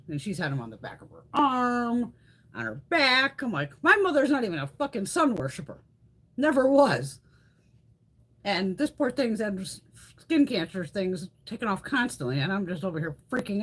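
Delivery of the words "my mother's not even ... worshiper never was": louder, higher, fired-up protest; indignant outburst. Real, not acted. Defending her mom to viewers, loud indignant outburst. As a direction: insist on it with real heat.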